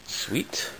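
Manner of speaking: a quiet pleased breath; whisper. Real, not acted. Admiring the bike rack in a whisper.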